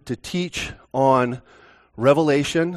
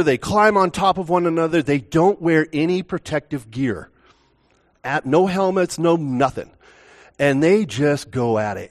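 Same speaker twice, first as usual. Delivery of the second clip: ordinary talk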